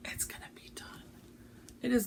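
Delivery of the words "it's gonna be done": whispered promise to herself; soft whispered resolve; determined whisper. Real, not acted. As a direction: breathe it like a secret vow. Vowing to finish this year.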